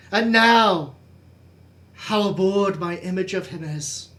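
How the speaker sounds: impassioned Shakespearean declamation with loud swells; theatrical lament. Acted. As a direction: mourn the jester aloud, swelling and then dropping back. Performs Hamlet's Yorick speech.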